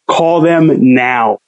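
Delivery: very slow, each word laid down; slow insistence. Retold or imitated. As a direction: spell it out as if to a child. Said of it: "He's like".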